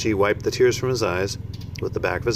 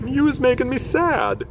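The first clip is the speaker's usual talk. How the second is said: much higher, sorrowful giant voice; on the edge of tears, rubbing his eyes; tearful giant. Acted. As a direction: giant saddened by Sophie's story. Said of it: "rubbing his eyes".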